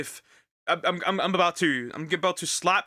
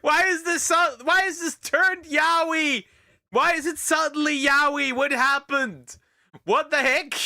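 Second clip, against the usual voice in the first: high, loud incredulous cries; can't believe the story turned; shocked disbelief; 11 semitones higher, 4 dB louder than usual. Real, not acted. Shocked that the joke skit turned romantic, crying out high.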